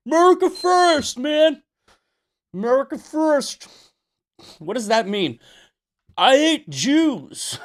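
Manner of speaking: high, loud sneering caricature of an America First guy; mocking groyper voice. Streamer mocks groypers in a loud high caricature voice.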